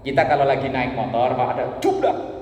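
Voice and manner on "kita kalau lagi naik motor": ordinary delivery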